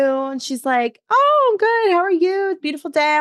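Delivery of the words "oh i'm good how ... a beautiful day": mock-cheerful high voice, lifted well up; bright high greeting. Homeless woman cheerfully greeting the narrator, high voice. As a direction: chirp it sweetly like a happy stranger.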